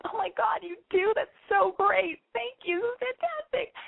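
high-pitched gushy squeal of delight; gushing girlfriend. Acted. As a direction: gush sweetly, way up high, mock-thrilled. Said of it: "And you're like".

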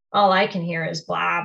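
ordinary delivery, nothing marked

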